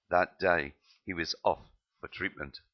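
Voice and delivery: plain reading voice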